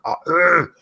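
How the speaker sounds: exasperated groan, breaking off the sentence; frustrated groan. Real, not acted. Frustrated mid-rant.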